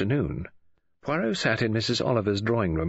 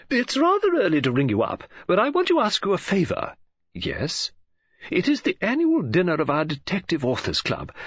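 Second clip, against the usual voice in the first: bright, higher-pitched woman's voice; Mrs Oliver; 9 semitones higher, 3 dB louder than usual. A narrator's reading. Novelist greeting Poirot on the phone, bright high voice. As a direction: play the chatty lady novelist, lifted and eager.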